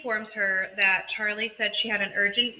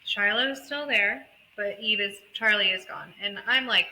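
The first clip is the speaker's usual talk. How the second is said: ordinary talk, nothing marked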